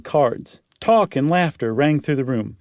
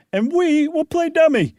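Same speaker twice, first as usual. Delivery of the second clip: mocking; 14 semitones higher, 4 dB louder than usual. [name] mocking Barnes.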